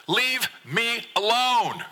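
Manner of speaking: very high, drawn-out cry; child's slow, high outburst; angry yell. A narrator's reading. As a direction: yell to be left alone, word by word. Upset son yells from bed.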